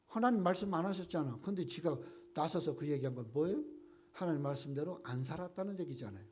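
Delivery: normal talking voice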